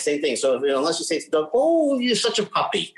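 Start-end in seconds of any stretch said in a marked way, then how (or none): 1.5-2.9 high-pitched mock gushing at a puppy; cooing pet talk. Owner talking to the dog, high-pitched imitated cooing.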